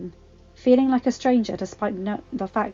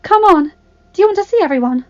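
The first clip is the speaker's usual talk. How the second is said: high, bubbly urging; eager young woman; 5 semitones higher, 7 dB louder than usual. Acted. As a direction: pull her along eagerly. Sophie urges Belle.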